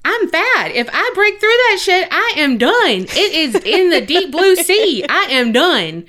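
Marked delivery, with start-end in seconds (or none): none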